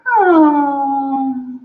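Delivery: short surprised moaning oh; a quick pleasured gasp of a moan; clit moan. Acted. Performer demonstrating the clit moan to the audience, moaning. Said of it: "there's the clit moan".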